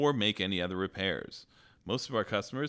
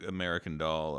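ordinary delivery

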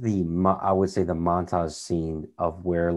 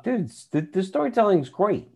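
retold enthusiasm; 11 semitones higher than usual. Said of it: "I was like".

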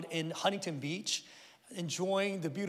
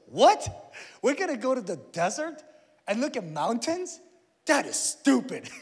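put-on higher, louder incredulous kid voice; blunt ten-year-old; 5 semitones higher, 6 dB louder than usual. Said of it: "who has no filter".

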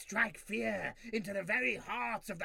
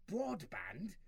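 ordinary talk, nothing marked

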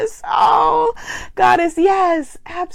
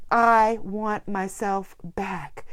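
quiet reading of a comment; 6 semitones lower, 8 dB quieter, much slower than usual. Retold or imitated. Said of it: "Goddess K says".

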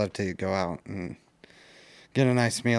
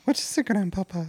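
character voice; 9 semitones higher than usual. Pretend. Sudden put-on character voice.

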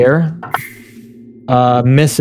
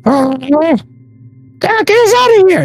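very high, squeaky goblin cry; high-pitched alarm in a creature voice; panicked goblin. Acted. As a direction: squeal it out like a frightened little goblin. Game master voices panicked goblin boss.

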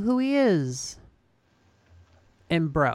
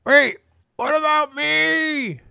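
high, loud, slow robot-henchman plea; whiny henchman; 10 semitones higher, 7 dB louder, slower than usual. Retold or imitated. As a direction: cry out like an abandoned sidekick. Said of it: "Trapjaw's like".

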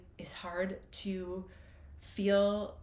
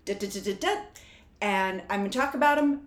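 fast, loud gushing of feelings; imitating partner; 5 semitones higher, 8 dB louder, faster than usual. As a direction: rattle off her feelings in a rush. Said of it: "And she's like".

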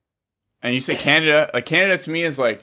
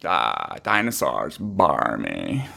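very low, slow creaky drawl; mock a listless Valley Girl; vocal fry; 8 semitones lower, much slower than usual. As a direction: let the words sag into fry. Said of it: "vocal fry thing".

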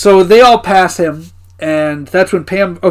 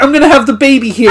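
mock panic; 7 semitones higher, faster than usual. Pam panicking in labor.

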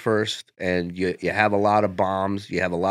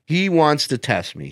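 ordinary talk

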